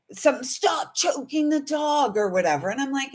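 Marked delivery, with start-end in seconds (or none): 0.1-2.2 higher, slow mock of the driver's yell; a lightly voiced scolding stranger. Imitating the driver yelling from his car, a mild mock yell. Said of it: "This dude yells at me out of his car".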